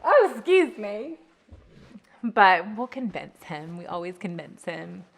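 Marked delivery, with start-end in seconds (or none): 0.0-1.2 mock indignant. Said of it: "He's like".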